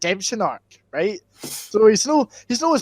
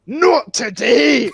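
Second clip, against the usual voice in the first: hugely stretched, raised-pitch cry; mock heroic shout; 4 semitones higher, 3 dB louder, much slower than usual. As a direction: drag out each word like a triumphant rescuer. Teacher stops the bully, retold.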